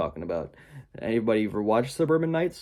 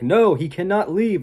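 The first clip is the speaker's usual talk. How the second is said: higher, louder villain refusal; witch forbidding; 7 semitones higher, 6 dB louder than usual. Retold or imitated. The witch refusing to let Nicholas leave, higher and louder. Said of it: "the witch is like".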